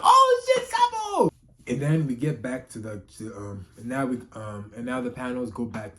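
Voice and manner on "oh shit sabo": much higher, loud excited shout; surprised shout. Retold or imitated. Reader reliving spotting Sabo in the chapter, excited shout. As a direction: yell it like you just spotted him. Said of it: "I was like".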